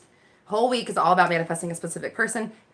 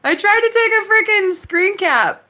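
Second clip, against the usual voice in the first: higher, louder, slower exasperated complaint; frustrated wail; 12 semitones higher, 6 dB louder, slower than usual. Real, not acted. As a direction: whine it out in frustration. Frustrated at failed screen cap.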